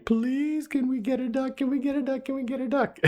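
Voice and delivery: high-pitched, frantic imitated begging; kids going bananas. Kids begging dad for a duck, frantic high imitation. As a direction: plead like overexcited children, far above your own pitch. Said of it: "literally just going bananas".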